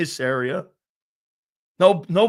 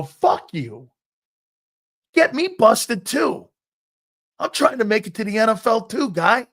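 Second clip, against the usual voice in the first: reenacted indignant outburst, climbing high and louder; so mad; 8 semitones higher, 5 dB louder than usual. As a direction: act out lashing out at a teammate. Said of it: "and I was so mad".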